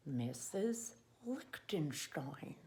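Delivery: slow, breathy whispered name; reverent whisper. Shown as a demonstration. Teacher admires the neat signature, slow reverent whisper. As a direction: whisper it like something precious. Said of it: "Very nice".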